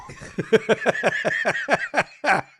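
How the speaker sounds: high uncontrollable laugh; relapsing giggles. Real, not acted. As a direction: burst out laughing mid-task.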